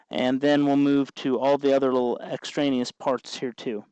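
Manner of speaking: ordinary delivery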